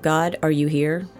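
ordinary talk

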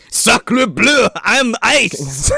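put-on hammy French accent shouting; french chef. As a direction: cry out in a mock French accent. Voicing frozen Sub-Zero, French.

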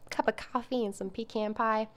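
a touch of Southern, "pecan" as pee-CAN; Southern charm. Shown as a demonstration. Host savoring the memory, lightly Southern charm. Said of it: "adds a little Southern charm to it".

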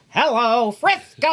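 high, loud holler; mock calling out. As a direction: belt it out across the room. Imagined shout heard across the room.

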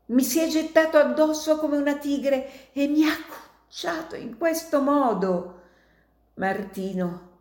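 high, strained voice of a wounded man; dying old miner. Acted. Dying miner urging Martino to flee, high and strained. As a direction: speak through fading strength.

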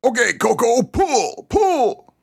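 very high, hammed-up command climbing on each pull; commanding yell. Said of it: "the farmer commanded".

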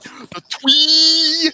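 "twee" said high and lisped for three; Dante's pronunciation. Retold or imitated. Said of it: "Dante's vocabulary of how he would pronounce it".

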